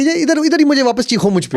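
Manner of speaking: high, pushed dare; retold shopkeeper daring him to shout back; mock taunting yell. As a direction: throw the challenge in his face.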